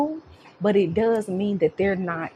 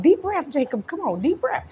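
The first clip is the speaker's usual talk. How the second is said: put-on soothing toddler; 9 semitones higher than usual. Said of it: "I'd be like".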